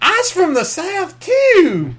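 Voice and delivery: high, slow Southern holler; wildly hammed-up Southern accent, last word drawn out; cartoon Southern drawl. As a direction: shout it back like an overjoyed cartoon Southerner. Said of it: "I love that Bugs Bunny cartoon".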